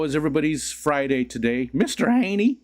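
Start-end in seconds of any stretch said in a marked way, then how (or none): 1.7-2.6 high-pitched rising sitcom call; Mister Haney catchphrase. Acted. Streamer quoting Green Acres to chat, high put-on voice. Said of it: "Green Acres, Mister Haney".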